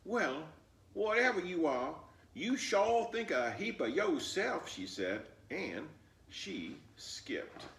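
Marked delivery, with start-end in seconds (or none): 0.0-4.7 higher, louder, cheeky retort; little girl voice. Acted. Flossie retorting to the boastful fox, cheeky. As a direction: toss it back like a sassy child.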